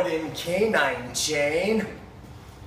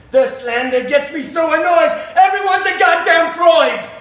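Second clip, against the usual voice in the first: much higher, much louder rapped yell; furious shout; 11 semitones higher, 7 dB louder than usual. Acted. Doctor raging at rivals' slander, rapped shout. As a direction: explode on the punchline. Rap climax, annoyed at slander.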